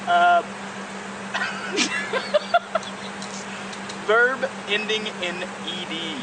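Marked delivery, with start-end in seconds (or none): 0.5-4.0 breathless giggling fit; can't stop laughing; wheezing real laughter; long high laugh. Real, not acted. Long laugh before reading word.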